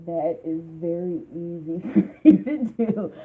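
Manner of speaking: slow, laughing through the words; a real amused laugh mid-line. Real, not acted. Tarot reader laughing at the client's habit of overdoing it.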